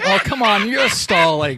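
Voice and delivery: loud, impatient complaint; exasperated. Acted. Joe to the stalling AI Biff, exasperated. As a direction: call out the stalling, fed up.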